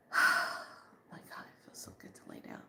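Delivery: breathy whisper of pure relief, quick. Pretend. Sinking into bed at last, whispered relief. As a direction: sigh it out as a whisper.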